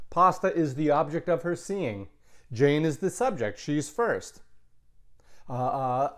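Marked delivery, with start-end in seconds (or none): none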